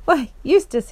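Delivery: high, loud joyful cry of surprise; a king overjoyed to see an old friend, calling out high and loud; astonished joy. Acted. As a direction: burst out with delighted astonishment. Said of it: "He gave a great laugh of astonished joy".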